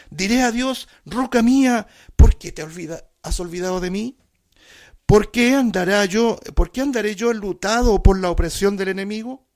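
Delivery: loud, high impassioned psalm cry; fervent preaching. Acted. Preacher to congregation, proclaiming the lament loudly. Psalm read at full force.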